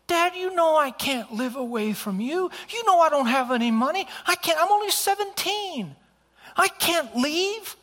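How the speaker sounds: put-on high, loud teenage protest; high-pitched, panicky pleading; pleading daughter. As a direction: imitate a distraught daughter wailing at her dad. Daughter pleads against father's ultimatum.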